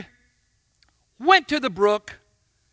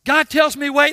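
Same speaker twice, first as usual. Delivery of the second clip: ordinary talk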